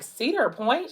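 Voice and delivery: high-pitched mock disbelief; incredulous question. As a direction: echo the place as if questioning the choice. Questions his choice of outing.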